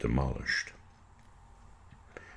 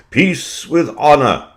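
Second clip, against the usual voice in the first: higher, slow, mock-solemn statesman; Chamberlain voice; 11 semitones higher, 7 dB louder than usual. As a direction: intone it like a proud prime minister making a pronouncement. Quoting Chamberlain's famous phrase.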